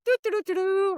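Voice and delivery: high sing-song doo-doo-doo; innocent humming. Retold or imitated. Customer sitting there pretending not to understand, innocent high humming. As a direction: hum high, playing oblivious. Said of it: "I'm sitting there like".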